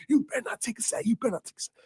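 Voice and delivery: a fretting coach pressing the point; animated coach. Retold or imitated. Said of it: "Borges get animated".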